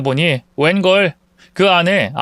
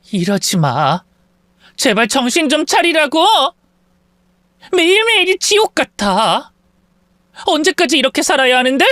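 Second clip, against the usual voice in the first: desperate plea; 10 semitones higher than usual. Acted. Wife pleading in quarrel.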